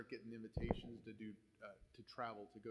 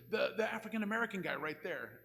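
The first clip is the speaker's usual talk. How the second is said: impatient louder repeat; 6 semitones higher, 5 dB louder than usual. Retold or imitated. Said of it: "And he goes".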